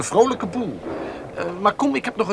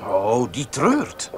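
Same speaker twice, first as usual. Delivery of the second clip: mock solemn; 7 semitones lower, much slower than usual. [name] mocks his father's grief.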